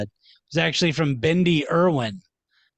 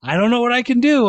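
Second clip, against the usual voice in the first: mock whiny player; 7 semitones higher, 4 dB louder, faster than usual. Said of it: "and he's always like".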